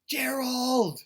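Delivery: loud high call for a friend; desperate shout of a name. Acted. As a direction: shout his name across the field. Piggy calling for elephant friend.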